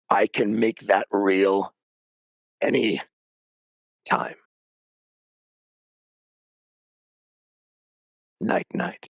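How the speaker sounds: slow, taunting demon voice ending in a lilting goodnight; a lightly voiced devil, drawn out and mocking; slow creepy whisper-free taunt, singsong at the end; demon voice in her head.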